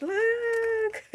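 a very high squealed "look"; excited squeal. Real, not acted. Showing viewers the Hello Kitty letter, squealing with delight. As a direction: squeal it, thrilled at the surprise.

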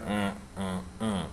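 low, soft grunted mms; footballer grunts. Retold or imitated. Footballer among his mates, grunting low and soft. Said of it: "they kind of just sit there and go".

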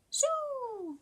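very high squeaky call; tiny bug calling. Acted.

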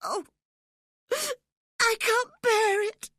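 very high, loud, drawn-out wail; sobbing. A narrator's reading. Terrified Linton breaks down before Cathy, sobbing. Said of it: "he sobbed".

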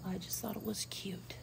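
hushed whisper outdoors so no one hears. Real, not acted. Whispering outside so the neighbors won't hear. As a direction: whisper it, no voice at all. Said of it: "Why am I still whispering?".

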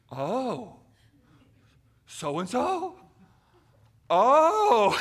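high, loud squeal of recognition, far above his speaking voice; overexcited recognition. Retold or imitated. Friend meeting the prayed-for newcomer, an overexcited squeal. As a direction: gasp it out like you've just met the man you prayed for. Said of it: "And he goes".

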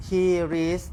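ordinary delivery

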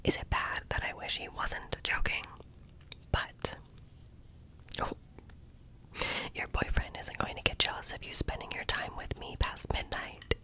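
ordinary delivery

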